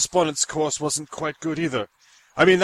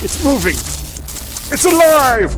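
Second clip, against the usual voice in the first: screaming, much higher and louder; triumphant shout; 9 semitones higher, 8 dB louder, slower than usual. Acted. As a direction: bellow it like a mad scientist. Creation comes alive.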